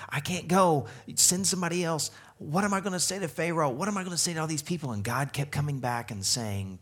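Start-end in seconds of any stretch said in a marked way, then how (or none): 0.1-4.9 high, hurried mock pleading; retold protesting. Moses objecting to God's sending him, high-pitched protest. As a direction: protest in a raised pitch, rushing the questions. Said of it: "Moses started protesting and saying".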